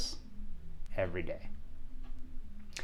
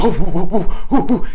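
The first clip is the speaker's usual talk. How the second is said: high, loud whoops; frantic whooping; 7 semitones higher, 10 dB louder than usual. Retold or imitated. Boy running with friend on his back, whooping. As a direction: whoop in quick bursts on the run.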